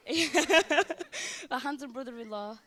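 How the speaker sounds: laughing. Real, not acted. Laughs mid-toast.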